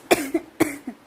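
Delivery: hacking cough. Acted. Cough video.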